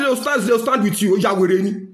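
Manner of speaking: ordinary talk, nothing marked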